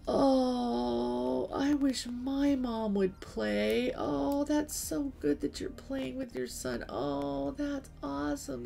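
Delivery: put-on high, excited, gushing young gamer voices; gushing kids. Kids in chat gush over a mom playing, high and excited. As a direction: coo it like thrilled kids. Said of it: "And they'd go".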